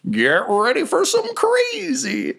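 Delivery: high, stretched-out showman hype; mock-excited, drawn-out build-up; hyped-up announcement. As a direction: tease the madness in a silly high voice. Game master hypes new mechanics.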